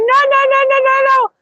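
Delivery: very high, rapid-fire refusal; imitated old lady. Old Spanish lady refusing a bag move, high-pitched imitation. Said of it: "And she went".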